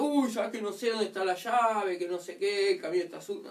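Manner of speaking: mock dithering, sinking back down; flustered excuse-maker. Acted excuse when alarm sounds.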